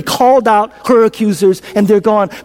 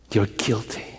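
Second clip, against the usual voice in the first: much softer, low; hushed refrain; 12 semitones lower, 13 dB quieter, slower than usual. Shown as a demonstration. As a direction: say it almost under breath. Refrain quietly spoken.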